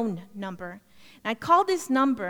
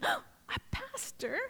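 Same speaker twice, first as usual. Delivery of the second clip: slow, stunned half-whisper; shocked disbelief; 5 semitones higher, 12 dB quieter, much slower than usual. Retold or imitated. Woman learning the stranger is a pastor, stunned whisper. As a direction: breathe it out in disbelief, soft and drawn out.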